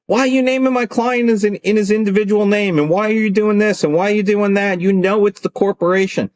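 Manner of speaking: high, heated complaint to opposing counsel; indignant lawyer on the phone; pissed-off rant. Retold or imitated. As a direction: demand answers, all worked up. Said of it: "Me, I'm all pissed".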